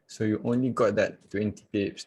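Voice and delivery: ordinary delivery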